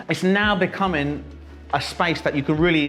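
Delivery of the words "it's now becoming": laughing. Real, not acted. Restarting after a fit of laughter.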